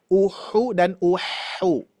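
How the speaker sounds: uhu said twice very slowly, softly; contrast uhu uhu. Shown as a demonstration. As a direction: compare the two h sounds. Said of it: "Bunyinya beda tidak".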